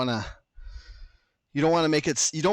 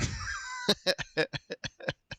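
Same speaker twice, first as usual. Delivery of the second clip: light, stuttering laugh; soft run of quick chuckles; 7 dB quieter than usual. Real, not acted. Host amused by his own rambling, soft chuckle.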